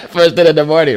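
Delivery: laugh, then say it still laughing; amused laugh. Real, not acted. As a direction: chuckle at the morning habit. Host laughs at own remark.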